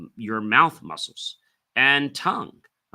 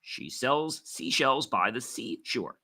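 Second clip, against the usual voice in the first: tongue twister a little quicker, still crisp; brisker, controlled articulation drill; speeding up; 4 dB quieter than usual. Shown as a demonstration. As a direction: pick up the pace with control. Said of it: "then speed up as you gain control".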